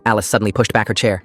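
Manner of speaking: ordinary delivery, nothing marked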